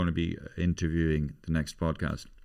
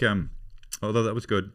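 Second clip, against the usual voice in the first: ordinary delivery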